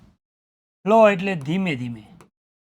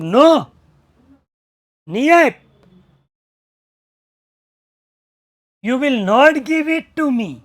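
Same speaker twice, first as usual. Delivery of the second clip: loud, higher, slow furious shout; shouted refusal; 6 semitones higher, 8 dB louder, slower than usual. Retold or imitated. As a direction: roar the refusal. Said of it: "રાડ નાખી જોરથી".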